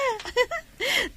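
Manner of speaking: a short delighted laugh; light laugh. Acted. Daughter laughs as her father meets his love. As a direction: laugh with glee at the surprise.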